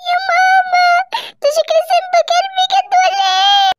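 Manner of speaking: high, crying panicked yell; a panicked high wail; frightened cry. Acted. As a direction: scream at the sight. Mother sees the monster.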